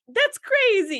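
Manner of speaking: mock disbelief. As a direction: play up the shock in a high pitch. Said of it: "you're not like".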